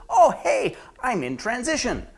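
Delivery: high, loud, fake-cheery front; brightly overdone reassurance; outside voice. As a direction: put on an upbeat cover story. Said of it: "The outside voice says".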